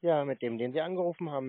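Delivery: ordinary talk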